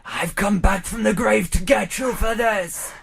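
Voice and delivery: loud, high zombie shout. Acted. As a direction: moan it like a hungry zombie. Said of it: "Zombie apocalypse horror".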